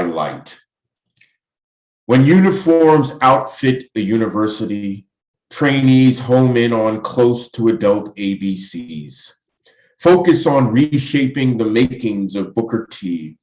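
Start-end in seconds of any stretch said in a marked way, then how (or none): 2.0-13.4 higher, projected poem reading; poetry recitation. Acted. Poet reading Dry Light to an audience, projected recitation. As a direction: declaim each line, pausing between phrases.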